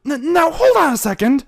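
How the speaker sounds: high, loud stammered interruption; cutting in. Acted. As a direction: jump in nervously. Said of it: "the showmare cut in".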